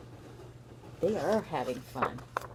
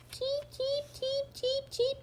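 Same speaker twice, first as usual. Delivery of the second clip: chirping chicks; slower than usual. Acted. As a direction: peep like tiny hatchlings. Baby birds chirping.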